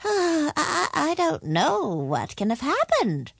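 high, faltering wizard voice; flustered wizard making excuses; nervous wizard. A narrator's reading. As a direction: stammer in bewildered dismay. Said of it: "the wizard said nervously".

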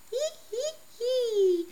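put-on high creaky old man's giggle; creaky old man cackle. A narrator's reading. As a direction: cackle like creaky worn steps. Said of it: "laughed in a creaky voice".

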